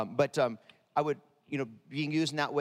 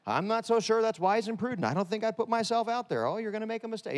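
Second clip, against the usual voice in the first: higher cautious inner accountant voice; 7 semitones higher than usual. Retold or imitated. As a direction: whine like a worried bookkeeper. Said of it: "How many have the accountant?".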